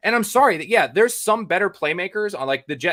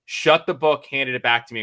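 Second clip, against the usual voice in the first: normal talking voice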